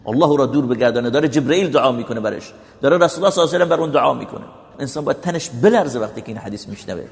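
ordinary delivery

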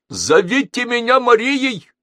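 high, loud call down the phone; high, loud cry to a deaf old man; grandma shouting. A narrator's reading. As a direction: shout her name into the receiver. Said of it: "крикнула бабушка".

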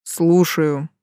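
low, languid purring male voice; languid seductive voice. A narrator's reading. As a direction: answer lazily, seductively. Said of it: "Томный голос суккуба".

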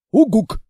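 loud falling hoot; firm hoot. Acted. Monster hoots firm agreement to the girl.

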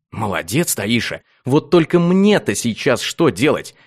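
ordinary delivery, nothing marked

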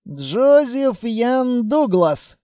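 slow, high, measured name; deliberate word-by-word delivery; enunciating each word. A narrator's reading. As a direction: hammer out each word separately. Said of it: "чеканя каждое слово".

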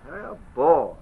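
ordinary delivery

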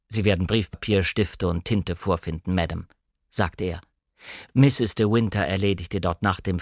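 a stiff, dignified household servant; formal butler. Acted. Narrator voices the butler.

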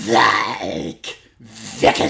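loud, drawn-out metal scream; Norwegian death metal. Shown as a demonstration. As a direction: roar it long like a death metal vocalist. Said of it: "Norwegian death metal, it's gotta be something like".

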